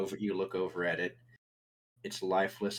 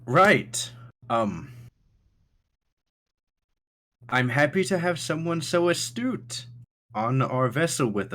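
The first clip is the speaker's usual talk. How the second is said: higher, awkwardly formal host; Thatch; 5 semitones higher, 4 dB louder than usual. Acted. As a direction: begin high and loud, then politely dismiss the guest. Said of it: "Thatch goes".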